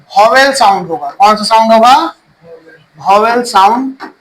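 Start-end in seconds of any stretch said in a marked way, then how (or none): 3.0-4.1 slow, word by word, for pupils to write down; spaced out, leaving time to write; slow dictation. Shown as a demonstration. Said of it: "यही लिखवाया मैंने".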